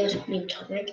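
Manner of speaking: quiet, strained sore voice; talking gently on a sore throat. Real, not acted. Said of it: "my voice is sore, so I can't really talk that loud".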